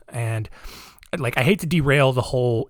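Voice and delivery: normal talking voice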